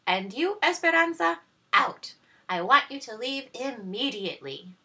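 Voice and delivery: loud, high, harsh scolding; cruel stepmother. Acted. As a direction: bark orders at the servants.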